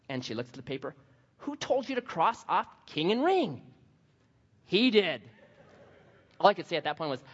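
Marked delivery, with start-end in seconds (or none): 1.4-3.6 teacher. Retold or imitated. Teacher quoted again. 4.6-5.2 put-on little boy. Boy blames again.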